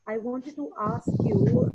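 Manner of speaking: ordinary talk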